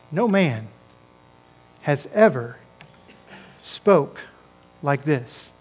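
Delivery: soft and slow, with long pauses between words; hushed awe. Retold or imitated. Guards tell their bosses of Jesus, hushed and awed. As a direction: let each word hang in quiet wonder.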